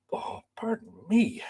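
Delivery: real unvoiced whisper after a burp; sheepish whispered apology to the stream; whispered pardon. Real, not acted. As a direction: breathe an apology off-mic. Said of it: "dinner's repeating on me".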